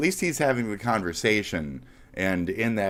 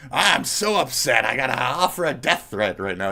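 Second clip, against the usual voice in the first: mock upset; 3 semitones higher, 5 dB louder than usual. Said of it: "What tipped you so".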